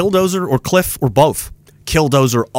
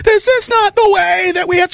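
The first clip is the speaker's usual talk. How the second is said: very high, hammed-up indignation; high mocking voice of the outraged; mock outrage; 13 semitones higher than usual. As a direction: wail it like an overwrought objector. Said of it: "they're acting like it's terrorism".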